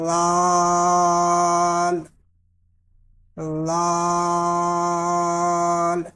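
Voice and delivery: لال drawn out slowly, clean; long laal. Shown as a demonstration. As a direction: stretch لال, no whistle. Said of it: "سیٹی کی آواز نہیں نکالیں گے".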